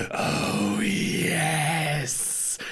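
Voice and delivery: long drawn-out creature "oh, yes"; put-on creature voice, stretched out; Twiggy powered up. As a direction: savour it like a creature filling with magic. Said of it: "his eyes glow bright red as he goes".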